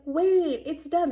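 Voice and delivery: loud, high burst of relief; surprised. Real, not acted. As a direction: shout it in happy disbelief. Sudden relief, visa sent.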